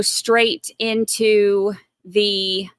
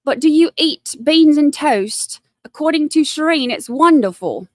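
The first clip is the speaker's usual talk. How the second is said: British accent impression, higher at first; mock British accent; 5 semitones higher, 3 dB louder than usual. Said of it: "I'm, I'm now British".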